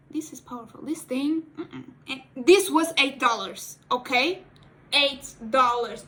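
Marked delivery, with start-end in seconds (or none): 2.1-6.1 emphatic price. Real, not acted. Stressing the low price.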